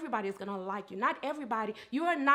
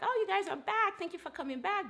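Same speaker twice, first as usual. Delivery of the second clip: higher-pitched, imitated cheery greeting; welcoming host; 8 semitones higher than usual. Restaurant owner welcoming a couple back, high excited greeting. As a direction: act out an owner greeting regulars.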